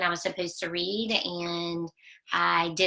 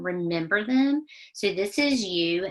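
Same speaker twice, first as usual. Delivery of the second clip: ordinary talk, nothing marked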